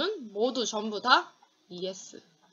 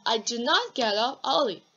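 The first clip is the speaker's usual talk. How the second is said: slow model sentence with not stressed; 4 dB louder, much slower than usual. Shown as a demonstration. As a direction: drill it slowly, lean on not. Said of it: "do not만 붙이면 돼요".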